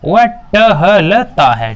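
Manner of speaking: "टहलता" drawn out slowly as ट-ह-ल-ता; syllable by syllable. Shown as a demonstration. Teacher showing learners what टहलता is, spelling it out slowly by syllable. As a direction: break "टहलता" into slow, separate syllables. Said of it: "टहलता क्या है".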